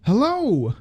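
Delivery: high called-out hello into the dark; yelling hello. A narrator's reading. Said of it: "she yelled again".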